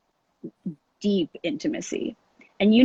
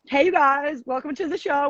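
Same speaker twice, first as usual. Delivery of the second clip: higher upbeat host restart; show host greeting; 6 semitones higher than usual. Real, not acted. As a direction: welcome them in like a host. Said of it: "We're gonna start over".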